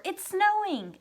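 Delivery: high-pitched startled announcement; shocked exclamation. Shown as a demonstration. Teacher giving an example of shock at the weather, shocked. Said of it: "are shocked by".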